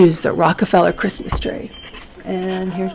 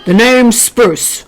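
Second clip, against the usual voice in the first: burly tree; 3 semitones higher, slower than usual. A narrator's reading. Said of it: "the burly tree said".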